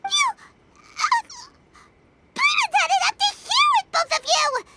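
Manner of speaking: exasperated outburst. Acted. Paimon to Miko and traveler, exasperated scolding. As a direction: sputter then snap out the complaint.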